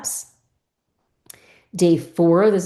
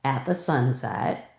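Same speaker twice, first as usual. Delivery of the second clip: natural speech; 3 semitones lower, 3 dB quieter than usual. Shown as a demonstration. Teacher modelling for a student, read it like speaking. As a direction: read it smoothly, like everyday talk. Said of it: "like you're speaking".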